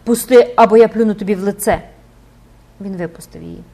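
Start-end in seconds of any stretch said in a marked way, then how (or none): 0.0-1.8 a loud, frantic threat; beside herself, shouting. A narrator's reading. The girl threatens to spit at the priest, loud shout. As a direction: yell it at him, out of control. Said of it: "не тямлячи себе, вигукнула дівчина".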